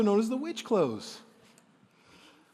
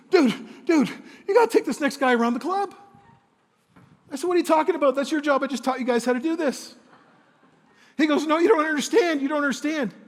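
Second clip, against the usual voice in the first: higher and louder, frantic; panicked urging; 7 semitones higher, 4 dB louder than usual. Retold or imitated. Frantic employee begging the boss, panicked. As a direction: blurt it out in a panic.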